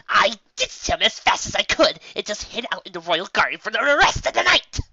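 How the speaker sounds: high, loud indignant rant. Acted. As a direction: blurt it out in mortified fury. Trixie ranting.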